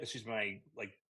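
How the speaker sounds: normal talking voice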